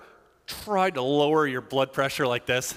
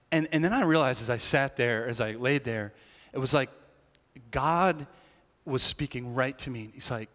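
ordinary talk, nothing marked

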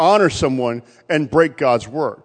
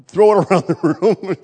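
laughing; 5 semitones higher than usual. Real, not acted. Laughs mid-quote.

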